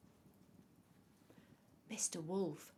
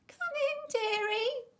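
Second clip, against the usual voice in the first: very high, loud fake granny voice; wolf imitating Granny; 10 dB louder than usual. A narrator's reading. Wolf lures the girl in, high fake granny voice. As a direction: coo it out high like a phony old lady. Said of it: "trying to sound like Granny".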